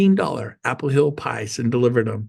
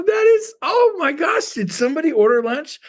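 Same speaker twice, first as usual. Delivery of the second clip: very high mock amazement; delighted surprise; 13 semitones higher than usual. Assistants smell the pizza he delivers, imitated delighted surprise. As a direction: mimic staff thrilled by unexpected food. Office staff react to free lunch.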